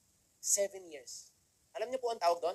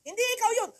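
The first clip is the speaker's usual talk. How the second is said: speaker's reply; 8 dB louder, much faster than usual. Retold or imitated. Speaker retorts to him.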